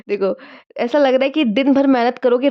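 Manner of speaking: usual speaking voice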